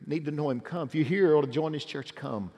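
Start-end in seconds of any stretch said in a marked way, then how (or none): none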